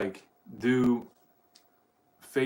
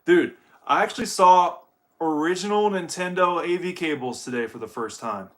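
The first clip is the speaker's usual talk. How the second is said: ordinary talk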